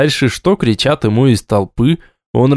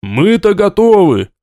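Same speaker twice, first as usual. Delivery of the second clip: shouting from the crowd; 7 semitones higher than usual. Acted. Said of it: "послышались выкрики из толпы".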